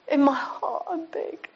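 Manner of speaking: choked up, tearful. Real, not acted. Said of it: "I was probably crying".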